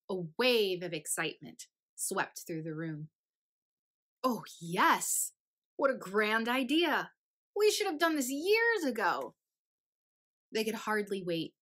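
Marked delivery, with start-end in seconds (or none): 4.2-9.3 louder, higher delighted exclaiming; excited cheering. Acted. The crowd of states thrilled by switching places, loud and delighted.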